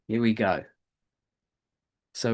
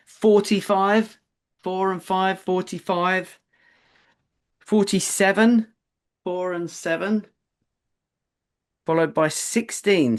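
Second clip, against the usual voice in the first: bingo caller; 5 semitones higher than usual. Acted. Tension building, calling numbers.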